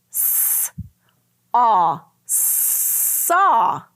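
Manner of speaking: slow segmented S, aw, saw; sounding out. Shown as a demonstration. Teacher sounding out saw for the class. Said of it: "sounding out word".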